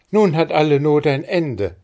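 put-on high, loud, jubilant girl; joyful cry. A narrator's reading. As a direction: cry out with relief and joy. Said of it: "rief Maren".

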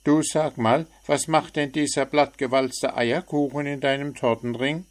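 a chirpy bird poking fun; cheeky little bird. A narrator's reading. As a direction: frecher kleiner Vogel. Bird character Zwitschi voiced.

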